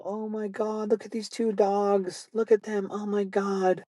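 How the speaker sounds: high-pitched, drawn-out cooing over dogs; gushing older couple. Retold or imitated.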